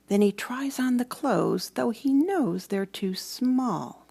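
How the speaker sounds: plain reading voice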